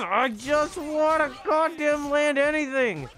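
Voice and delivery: high, slow exasperated wail; frustrated whine. Real, not acted. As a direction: drag it out, fed up. Frustrated after missing moves.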